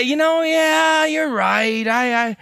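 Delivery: high-pitched, hammed-up emotional admission; drawn-out, high, mock-emotional agreement; very emotional confession. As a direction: overplay a tearful, relieved confession. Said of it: "they'll be very emotional".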